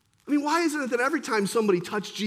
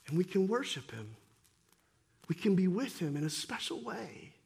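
softer, lower preaching; hushed sermon appeal; 6 semitones lower, 8 dB quieter than usual. Acted. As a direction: pull the room in with a soft plea.

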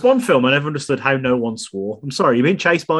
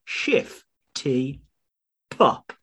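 stretched, slow announcer read; slow grand intro; 4 semitones higher, much slower than usual. Acted. [name] welcomes listeners to the episode, slow announcer voice. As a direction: draw out each title for drama.

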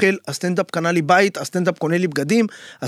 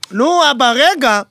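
put-on higher, louder whiny teenage daughter; annoyed teen daughter; 7 semitones higher, 5 dB louder, slower than usual. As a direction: brush dad off like a busy teen. Daughter voiced in father's story.

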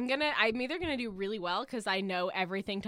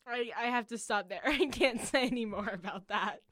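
ordinary delivery

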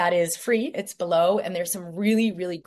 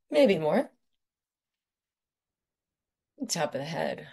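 guided meditation. Acted. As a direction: lead them gently through each point.

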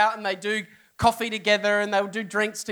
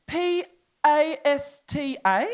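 spelling it out; 5 semitones higher, much slower than usual. Retold or imitated. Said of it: "And then she goes".

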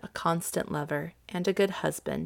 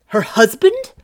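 loud, rising, indignant query; angry question; 3 semitones higher, 9 dB louder than usual. Acted. Duke to Olivia on hearing of a husband, angrily. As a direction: fling it back as an outraged question. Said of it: "asked the Duke angrily".

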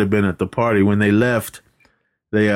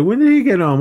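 high, louder, alarmed ask; startled question; 12 semitones higher, 4 dB louder, faster than usual. Retold or imitated. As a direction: blurt it out high, like they just got spooked. Scared tenants asking in a rush.

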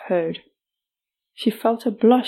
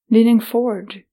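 ordinary delivery, nothing marked

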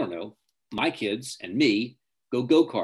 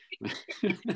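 light laugh; 9 semitones higher, 5 dB quieter than usual. Real, not acted. Laughs at own joke.

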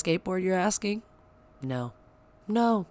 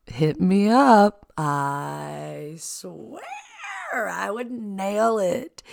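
announcer pitch; 4 semitones higher, 7 dB louder, much slower than usual. Acted. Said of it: "pitching herself for voiceovers".

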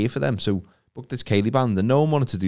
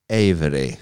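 "It's [name]" then a pause, "Avery" said slowly and deliberately; name spelled out carefully; 5 semitones lower, slower than usual. Shown as a demonstration. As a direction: announce the surname as if down a crackly phone line. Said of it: "I have to say it in that, that way".

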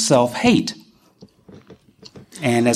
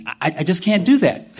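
ordinary delivery

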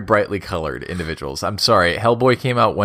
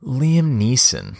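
slow announcement; 3 semitones higher, much slower than usual. Real, not acted. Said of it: "character voice of the week I'm gonna ask you to do is Liam Neeson".

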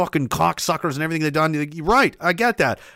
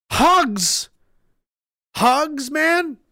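higher, scornful repeat of the word; mocking; 10 semitones higher, much slower than usual. Real, not acted. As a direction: sneer at the idea of hugs. Mocking the idea of hugs.

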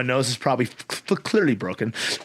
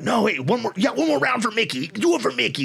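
higher, pushy rallying plea; rally him for one more round; urging on; 8 semitones higher than usual. Retold or imitated. Corner man urges his fighter on for one more round, higher pitched.

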